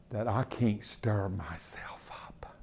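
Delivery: very slow, low whisper; slow, barely voiced confession; hushed. Real, not acted. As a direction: let it fade to a grave whisper. Solemn hushed point in sermon.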